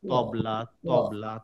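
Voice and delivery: তবলা slow, low and drawn out; slow demo word. Shown as a demonstration. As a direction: spell out তবলা slowly. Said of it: "তবলা যখন বলবো তখন লিখবো হচ্ছে এই ত".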